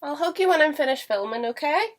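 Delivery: reassuring a pet. Real, not acted. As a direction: soothe the cat in a high voice. Promising the cat attention later.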